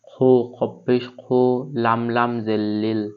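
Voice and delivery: ordinary delivery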